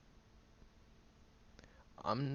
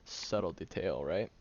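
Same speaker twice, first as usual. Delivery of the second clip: dry mock agreement, higher pitch; sarcastic; 6 semitones higher, 4 dB louder than usual. Mocking the friend's obvious observation, sarcastic. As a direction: lay the sarcasm on about the subtle detail. Sarcastic about not caring.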